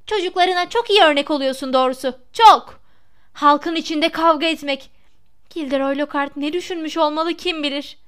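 outraged mother's telling-off; exasperated. A narrator's reading. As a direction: tell off your husband with biting sarcasm. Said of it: "Missus Weasley ise çileden çıkmıştı".

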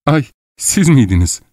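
high-pitched exclamation of surprise; startled cry. A narrator's reading. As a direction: cry out as if caught off guard. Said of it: "diye haykırmıştı". (şaşkın, tiz bir haykırış)

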